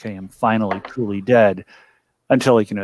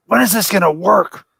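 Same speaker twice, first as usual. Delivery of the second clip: raised, high exasperated outburst; frustrated cry, pitched way up; exasperated yell; 10 semitones higher, 5 dB louder than usual. Acted. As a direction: yell it out in exasperation at another failure. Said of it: "like, and yell, like, an obscenity".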